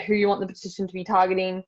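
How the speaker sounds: ordinary talk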